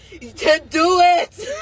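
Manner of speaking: slow, loud and high, sobbing out the words; crying shout. Real, not acted. Taunting her enemies while breaking down in tears, crying shout. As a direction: cry it out as a challenge.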